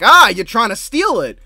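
loud, high mock accusation; mock accusing shout. Jake Long catching Danny, a hammed-up accusing shout. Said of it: "Jake Long shows up like".

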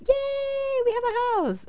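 very high squeaky yay; thrilled at the new house; excited cheer. Real, not acted. Celebrating finished dog house.

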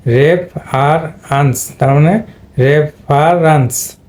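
রেফ, আর, আনস spaced slowly, then রেফারেন্স; syllable by syllable. Shown as a demonstration. Teacher joining the syllables for students, slow spaced রেফারেন্স. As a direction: slowly build রেফারেন্স from ref, ar, ans. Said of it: "রেফ হয়ে গেছে".

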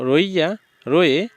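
ordinary talk

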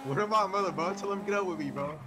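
usual speaking voice

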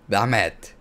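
ordinary delivery

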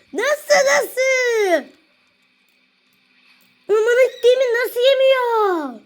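high-pitched crying-out at the game; exasperated wailing. Real, not acted. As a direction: wail it high, stretching the last vowels. High whining outburst over the game. (tiz sesle isyan edercesine)

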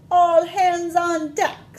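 high, loud, slow pirate cry; little pirates. Acted. Little pirates answering mommy in the bath, high slow pirate cry. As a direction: bellow it like a tiny ship captain. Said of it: "say her little pirates".

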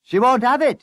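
high, loud exasperated shout. A narrator's reading. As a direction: yell the bad news to the boss. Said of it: "he shouted".

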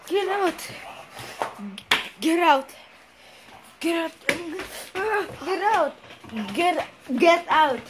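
high, urgent repeated cries; frantic ordering. Acted. Ordering clown out of the house, frantic. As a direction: drive the intruder out in panic.

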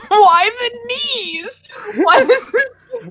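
high laughing squeal. Real, not acted. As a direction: laugh through the words. Laughing at knee kick.

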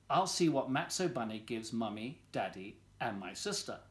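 ordinary delivery, nothing marked

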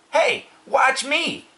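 put-on high, drawn-out boy's yell. A narrator's reading. Avery showing off, high drawn-out yell. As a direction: yell for attention, high and slow. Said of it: "yelled Avery".

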